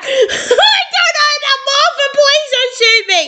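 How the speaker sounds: high voice, laughing through the words; laughing plea. Real, not acted. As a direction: giggle out a helpless plea. Laughing at own confusion.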